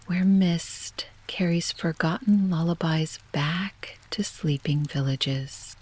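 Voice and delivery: plain reading voice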